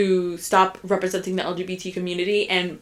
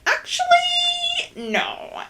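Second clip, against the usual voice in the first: high correcting voice; much slower than usual. Retold or imitated. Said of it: "I was like".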